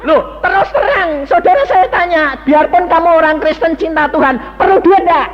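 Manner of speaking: bertanya bersemangat. Real, not acted. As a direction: prod the congregation for an answer. Bertanya langsung ke jemaat. (bertanya ke jemaat dengan suara lebih tinggi dan keras)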